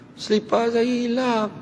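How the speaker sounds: high, slow plaintive woman's voice; high slow lament over lost slippers. Acted. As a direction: pitch it up like the upset woman.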